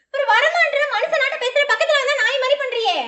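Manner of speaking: loud exasperated scolding; laughing scolding. Real, not acted. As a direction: scold him, half laughing. Scolding loudly, half laughing.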